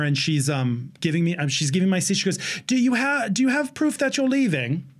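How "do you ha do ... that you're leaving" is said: higher, lightly put-on New Zealand accent. Ticket agent asking a traveler for proof, New Zealand accent. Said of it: "I get up to the ticket counter".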